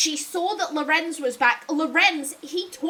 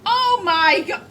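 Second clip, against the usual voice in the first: exasperated outburst; 3 semitones higher, 5 dB louder, much slower than usual. Real, not acted. Player reacting to a game character, exasperated outburst. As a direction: blurt it out in disbelief.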